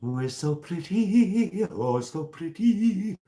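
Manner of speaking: high, loud, drawn-out gush; excited squeal. Real, not acted. Admiring something pretty on her stream, excited squeal. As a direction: squeal it out, stretching the last vowel.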